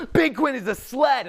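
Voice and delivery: put-on high, loud child's rallying shout; yelling kid. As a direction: yell it out like a kid setting off a mob. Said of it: "some kid would yell".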